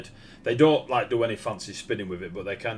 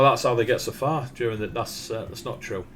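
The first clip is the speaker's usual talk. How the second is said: ordinary talk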